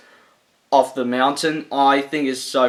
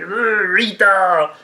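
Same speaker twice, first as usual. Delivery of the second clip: slow, higher growly monster groan; Goldar voice; 6 semitones higher, 4 dB louder, much slower than usual. Retold or imitated. Imitating Goldar calling for Rita, slow and hammed up. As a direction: drag it out like a clumsy monster. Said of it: "having Golda talk like this with a voice like".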